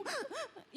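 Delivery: high, hiccuping gasp of a cry; sobbing gasp. Shown as a demonstration. Preacher shows Hannah's sobbing hiccups, gasping. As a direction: show the catching breath of someone crying hard. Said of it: "parang nasisinok".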